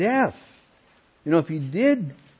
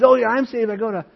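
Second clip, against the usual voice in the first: eager retelling; 7 semitones higher, 4 dB louder than usual. Retold or imitated. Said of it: "He says".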